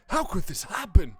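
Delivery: mock-tragic outcry; hammed-up dismay. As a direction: melodramatically mimic an anguished cry. Echoing game character's anguished cry.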